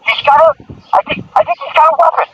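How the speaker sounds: high-pitched cop raising the alarm; retold cop. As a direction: call it out as a worried officer. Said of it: "he goes".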